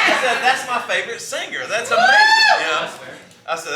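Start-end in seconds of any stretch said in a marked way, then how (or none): 0.4-2.6 very high, loud mock-ecstatic yell; hammed-up fan excitement, rising to a squeal; thrilled outburst. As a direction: gush it at the top of your voice. Retold thrilled reply to the fan.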